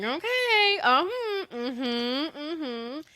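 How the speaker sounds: very high, sing-song okay and mm-hmms; mimicked sassy agreement. Mother showing how she used to answer, high and sassy. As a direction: parody your younger self brushing off a request. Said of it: "say things like that".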